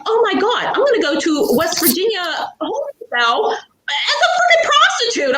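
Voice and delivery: high, half-laughing dread; laughing panic. Retold or imitated. Alone in the cop car, high half-laughing panic. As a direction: squeak it out in giddy horror.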